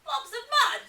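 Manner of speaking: Dingo, startled and amused; surprised Dingo. Acted. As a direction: squeak out the question in surprise. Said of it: "Dingo laughed".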